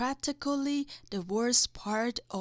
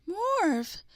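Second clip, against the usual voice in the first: delighted call; 7 semitones higher than usual. A narrator's reading. Jim recognizes the prankster blob.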